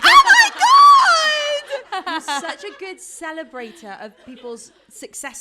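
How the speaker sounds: very high, very loud, gushing praise; gushing shriek. Retold or imitated. Friend cheering a tiny success over FaceTime. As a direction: gush like she's hearing huge news. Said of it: "And she'll be like".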